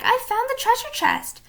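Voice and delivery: high, excited exclamation; cried. A narrator's reading. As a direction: burst out with the discovery. Jack thrilled at discovery.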